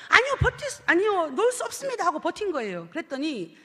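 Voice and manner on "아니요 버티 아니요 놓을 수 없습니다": panicked refusal. Retold or imitated. Man refuses again.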